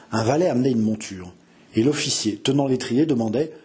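normal talking voice

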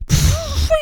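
short airy whistle rising high; impressed whistle. A narrator's reading. Girl looks around the room, whistling. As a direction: blow out a whistled pfffouit. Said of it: "siffla-t-elle en observant autour d'elle".

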